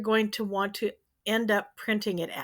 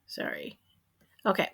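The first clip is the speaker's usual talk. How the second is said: whispered quick apology; barely voiced sorry, aside; whispering; 7 dB quieter than usual. Real, not acted.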